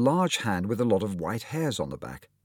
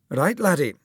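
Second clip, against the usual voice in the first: higher, louder Scottish surgeon; Scottish accent; 9 semitones higher, 6 dB louder than usual. Acted. Surgeon greeting his young patient, Scottish accent. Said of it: "with just a hint of a Scottish accent".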